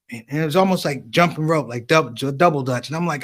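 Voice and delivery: ordinary delivery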